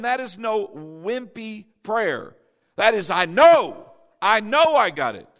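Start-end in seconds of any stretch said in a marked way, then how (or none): 3.1-5.3 loud, sure declaration; confident shout. Shown as a demonstration. Preacher showing the church a bold declaration. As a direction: proclaim it with total certainty. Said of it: "Everybody say it like that".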